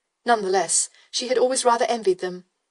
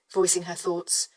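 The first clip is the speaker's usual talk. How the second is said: ordinary delivery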